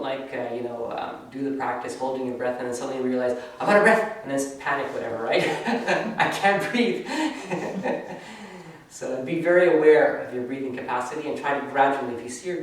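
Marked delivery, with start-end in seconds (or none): 3.6-4.2 mock panic. Student suddenly panics mid-practice. 4.9-5.7 laughing. Real, not acted. Laughs at the mock panic. 6.2-7.1 high, laughing fake gasp for air; mock panic. 7.1-8.8 high amused chuckle; high laugh. Real, not acted. Laughs after mock panic.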